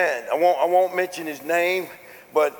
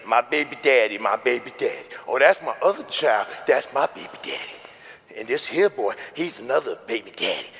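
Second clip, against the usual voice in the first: street talk impression; 7 semitones lower, 3 dB quieter than usual. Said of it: "the word in the street is".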